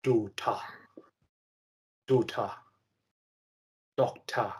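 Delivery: "Do, ta" repeated softly in two parts; syllable by syllable. Shown as a demonstration. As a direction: echo "Do, ta" low, ending on an open "ta". Learner repeats the syllable demo.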